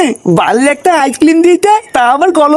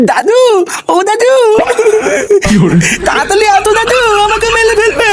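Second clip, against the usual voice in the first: high, fake-tearful wail for grandpa; crying for help; 6 semitones higher than usual. Child wailing to grandpa to save him from father. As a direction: whimper and call out, pleading.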